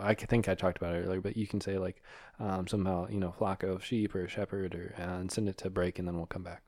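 very soft, low instructions; hushed, low aside to a co-host; whispering off mic. Real, not acted. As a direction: give directions under your breath. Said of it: "I'm whispering".

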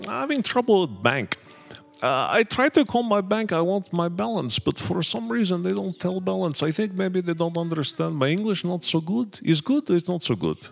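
high, broken-English Russian student voice; lightly mocking Russian accent; imitating a Russian accent.

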